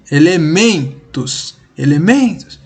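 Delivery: ELEMENTOS with a long, emphatic MEN; stress on MEN. Shown as a demonstration. Teacher picks out the tonic syllable, stressing MEN in ELEMENTOS. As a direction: make MEN stand out in ELEMENTOS. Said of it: "é men".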